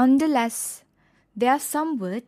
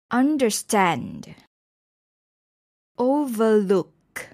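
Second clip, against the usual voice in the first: slow underSTAND, overLOOK; stress on last part; slower than usual. Shown as a demonstration. Teacher showing compound verbs, last part stressed in "understand", "overlook". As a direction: draw out "understand" and "overlook", weight on the end. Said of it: "the stress is also on the last part".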